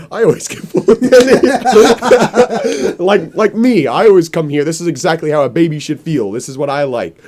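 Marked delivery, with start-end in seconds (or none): 0.1-3.9 high, loud, breaking into laughter; laughing. Real, not acted. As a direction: lose it mid-sentence. Quoting baby men, cracking up.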